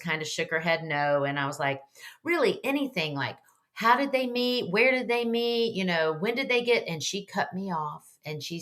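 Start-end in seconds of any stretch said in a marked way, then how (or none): 2.2-6.9 higher, mildly insistent retold questioning; pressing questions. Niece begging her great-aunt for any family details, mildly insistent.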